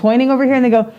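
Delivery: usual speaking voice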